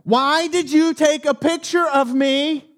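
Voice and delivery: hammed-up furious demand, dragged out; imitating the woman yelling through the window; retold scream. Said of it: "while she's screaming at me".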